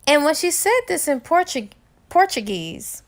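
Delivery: plain reading voice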